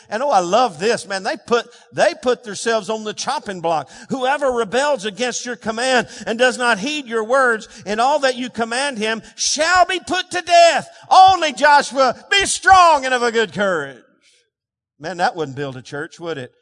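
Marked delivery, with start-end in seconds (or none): 4.1-14.0 reading that builds to a shout; rousing pulpit declamation; preaching scripture. Acted. Reads scripture at full force.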